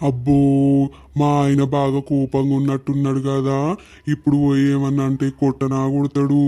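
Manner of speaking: a hint of a scared husband; timid husband. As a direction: mutter it low, nervously.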